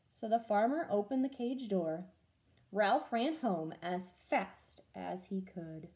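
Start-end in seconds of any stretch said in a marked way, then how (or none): none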